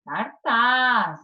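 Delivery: CARTAZ with the final TAZ stretched and emphasized; tônica na última sílaba. Shown as a demonstration. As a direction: stress the last syllable of CARTAZ. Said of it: "a sílaba tônica é o tas". (cartaz, esticando o TAZ final)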